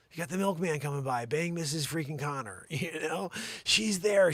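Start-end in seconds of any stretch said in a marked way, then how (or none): none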